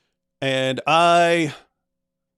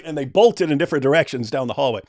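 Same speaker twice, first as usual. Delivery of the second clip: ordinary delivery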